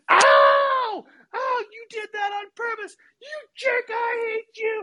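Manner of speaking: very high squealing little-brother scream; wailing tattletale child in high falsetto. Retold or imitated. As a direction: imitate a hurt kid shrieking in outrage. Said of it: "and he starts screaming".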